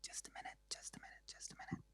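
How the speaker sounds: soft whispered repeats. Real, not acted. Host whispers to the audience to hold on. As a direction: murmur it breathlessly to buy time.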